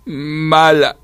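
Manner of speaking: hurt little creature accusing, on the verge of crying; near tears. A narrator's reading. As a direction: pout the word out tearfully. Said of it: "al borde de las lágrimas".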